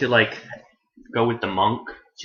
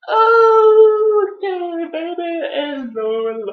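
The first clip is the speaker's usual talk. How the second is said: very high, drawn-out comic wail; mock wailing; 7 dB louder, much slower than usual. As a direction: stretch it out, starting loud and high. Acts out a figure's cry.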